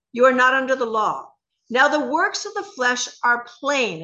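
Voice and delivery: measured, emphatic Bible reading; scripture reading. Acted.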